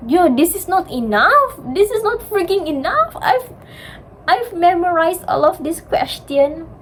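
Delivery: high-pitched exasperated rant, retold; mock panic about not being ready; retold frustrated outburst. Retold frustration after the exam.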